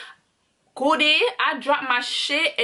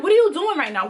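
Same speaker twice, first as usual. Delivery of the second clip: higher-pitched, quick outraged question; retold heated demand; 4 semitones higher, 4 dB louder, faster than usual. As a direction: throw the question out high and heated. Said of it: "So I'm really heated".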